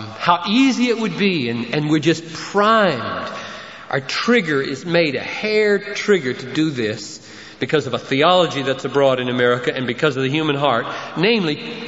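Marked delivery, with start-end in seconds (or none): none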